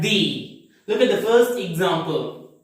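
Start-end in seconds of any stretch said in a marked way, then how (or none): 0.0-0.6 "the" said as thee, with long ee; long ee vowel in "the"; strong form of the. Shown as a demonstration. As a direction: draw out "the" to rhyme with see. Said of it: "have to pronounce this word as".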